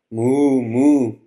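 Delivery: two loud, drawn-out moos; mooing. A narrator's reading. Woman mooing back to the hurt cow, loud moos. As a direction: moo long and loud like a cow. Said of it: "the woman moos".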